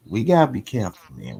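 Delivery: ordinary talk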